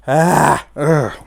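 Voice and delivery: loud teary gasp then groan; real choked sob-gasp; choked emotional gasp. Real, not acted. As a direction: catch the breath as tears come. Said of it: "Sorry, that's just".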